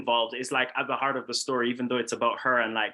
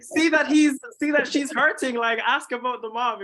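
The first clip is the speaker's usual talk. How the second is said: high, loud pleading of an exasperated viewer; mock annoyed; 12 semitones higher, 5 dB louder than usual. Filmmaker voicing commenters pleading for the mom, mock annoyed. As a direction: imitate fed-up commenters, pitched way up with a laugh in it. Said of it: "people are so annoyed with him".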